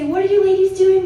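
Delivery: high, loud chirpy greeting; cheery valet. Retold or imitated. Valet greeting the ladies on arrival, high chirpy welcome. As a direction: sing it out bright and welcoming. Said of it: "they say".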